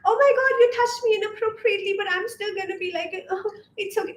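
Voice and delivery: high-pitched parody of a too-sweet woman; mock airy, bubbly. Woman parodying sweet girls excusing bad behaviour, mock airy and bubbly. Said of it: "airy and fun and loving and bubbly".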